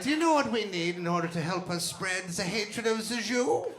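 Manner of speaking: high, hammed-up villain rallying the troops; mock Nazi orator. Nazi addressing comrades about recruiting, hammed-up oratory.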